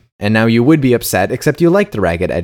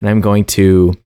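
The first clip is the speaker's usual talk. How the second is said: normal talking voice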